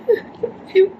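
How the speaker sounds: tearful I love you; crying. Real, not acted. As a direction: voice cracking with tears. Crying while sending love.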